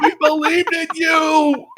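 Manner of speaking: high, slow, hammed-up shout of betrayal; mock wounded villager. Villager shouting at the player who betrayed them, hammed-up high wail. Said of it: "they're like".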